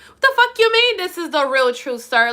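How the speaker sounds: incredulous, pitched high and loud at first; high-pitched outburst settling into a scornful challenge; indignant retort. Acted. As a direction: throw the title back at him in disbelief. Indignant retort to his video title.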